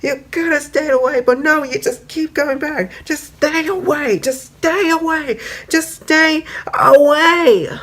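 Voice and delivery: very high, frantic repeated shouting; unhinged, building to a shriek; hysterical rage. Retold or imitated. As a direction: Rainbow Dash stabbing Pinky, screaming at her to stay away. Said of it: "she was just like".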